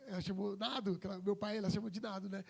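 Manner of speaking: ordinary talk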